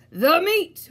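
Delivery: high, loud roar; crew roar. A narrator's reading. Pirate crew roaring for meat, high and loud. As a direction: roar it out together. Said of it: "we all roared".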